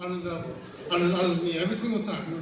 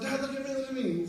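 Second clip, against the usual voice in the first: put-on high, eager kid's call; calling for attention; 6 semitones higher than usual. Buddy on the swing calling Dad, calling for attention. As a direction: imitate a boy showing off to Dad.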